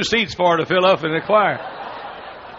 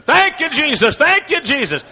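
joyful praise; 10 semitones higher than usual. Retold or imitated. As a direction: shout your thanks like your prayer was just answered. Wife rejoicing, shaking people.

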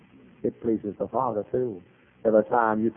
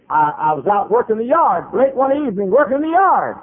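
climbing higher and louder; mounting exasperation; 11 semitones higher, 6 dB louder than usual. Retold or imitated. Lady telling of being found in her yard, rising exasperation. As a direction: build to a high, loud complaint. Acting lady's mounting distress.

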